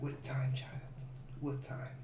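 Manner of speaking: soft whispered oracle line; whispered prophecy. Acted. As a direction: breathe it like a secret prophecy.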